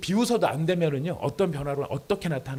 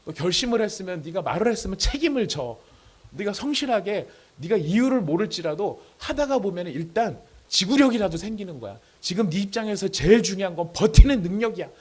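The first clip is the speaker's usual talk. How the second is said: pressing dad lecture, raised pitch; retold stern scolding; 6 semitones higher, 7 dB louder than usual. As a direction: re-enact pushing your son to take responsibility. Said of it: "막 다그치면서 화를 내는데". (아들을 다그치는 아빠 흉내, 높아진 목소리)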